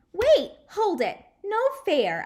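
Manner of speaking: high, loud, slow whine of protest. Acted. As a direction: wail it like a kid shut out. Junie B protesting being shut out.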